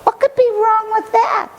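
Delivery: put-on high, eager boy voice; boyish reasoning. Boy at the fence convincing himself, high pitched. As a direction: talk yourself into it, high and bright. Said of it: "he told himself".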